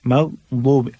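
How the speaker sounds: slow, drawn-out chanted verse; Quran recitation. Shown as a demonstration. Teacher recites the verse slowly as a model. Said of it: "अब ये जो दाद है".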